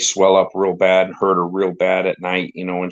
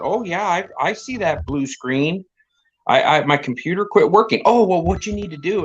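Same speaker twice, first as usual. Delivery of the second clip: put-on higher, innocently clueless old lady act; mock-naive, higher-pitched going along with it; grandma playing dumb; 7 semitones higher than usual. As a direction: play along with the caller, feigning helplessness. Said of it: "pretending she's dumb and".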